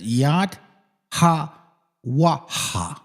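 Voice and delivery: "Yad", "Ha", "Waha" with pauses between; syllable-by-syllable "Yad Ha Waha"; sounding out. Shown as a demonstration. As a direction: read out "Yad Ha Waha" one piece at a time. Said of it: "and then it says".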